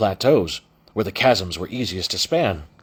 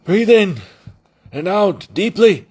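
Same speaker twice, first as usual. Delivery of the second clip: higher, louder muffled coaching; 10 semitones higher, 6 dB louder than usual. Acted. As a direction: coach him through a muffled wall. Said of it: "said the muffled voice".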